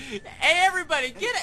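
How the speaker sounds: high, loud call across a shop. Acted. Clerk summoning shoppers to hear a customer, calling out loudly. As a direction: holler to get everyone's attention.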